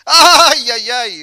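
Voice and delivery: mock fearful wail, loud high start; voces de miedo. Shown as a demonstration. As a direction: act out a yelp of fear that tails off. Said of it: "¿Y cuáles serían las voces de miedo?". (grito de miedo fingido)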